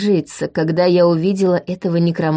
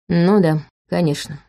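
ordinary delivery

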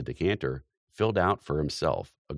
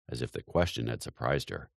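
ordinary delivery

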